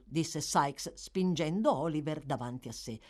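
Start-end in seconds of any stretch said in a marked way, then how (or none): none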